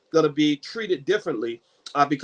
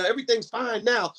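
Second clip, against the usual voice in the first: higher-pitched imitation of forced okayness; unconvincing reassurance; 7 semitones higher than usual. Stressed man claiming he has recovered, unconvincingly upbeat. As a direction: insist it's okay without sounding okay. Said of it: "he didn't sound like everything's fine".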